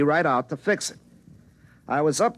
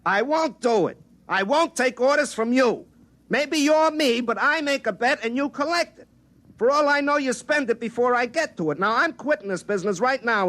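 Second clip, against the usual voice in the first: high, heated refusal; angry refusal; 11 semitones higher than usual. Acted. Refusing orders from future self.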